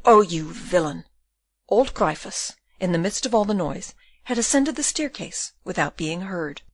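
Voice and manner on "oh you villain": loud, angry accusation; forceful, indignant fury; roared. A narrator's reading. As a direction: hurl the insult at him. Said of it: "roared Van Baerle".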